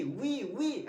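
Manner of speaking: वी with rounded lips. Shown as a demonstration. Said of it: "ऐसे होठ गोल होना चाहिए".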